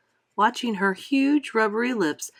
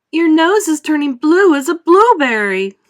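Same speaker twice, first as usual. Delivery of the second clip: screamed; 9 semitones higher, 11 dB louder than usual. A narrator's reading. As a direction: shriek in horror at the change. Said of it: "screamed Missus Beauregard".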